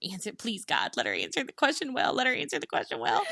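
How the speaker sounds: hushed, hurried pretend begging; mock pleading prayer. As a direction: imitate someone praying under their breath. Imitating partner's anxious silent prayer.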